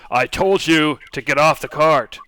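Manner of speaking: half-shouted threat from a thug; thug yelling. Acted. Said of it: "pulling out his weapons and yelling".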